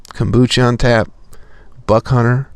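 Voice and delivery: ordinary talk, nothing marked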